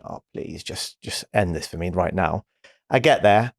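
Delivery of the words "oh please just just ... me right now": hushed, low plea under the breath; inward pleading. Retold or imitated. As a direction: softly beg for it to end, low and weary. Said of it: "I'm like thinking".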